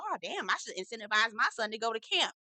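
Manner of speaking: ordinary talk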